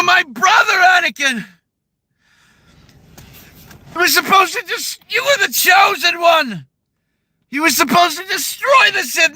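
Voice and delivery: desperate high-pitched shouting Obi-Wan; Ewan McGregor's Obi-Wan. Retold or imitated. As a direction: scream it at a fallen brother. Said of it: "that's Ewan McGregor's one".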